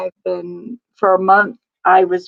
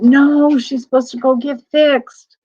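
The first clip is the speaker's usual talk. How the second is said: higher, louder protest; surprised protest; 5 semitones higher, 4 dB louder than usual. Retold or imitated. Telling her husband the dog can't be in heat, surprised and louder. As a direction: blurt it out in disbelief.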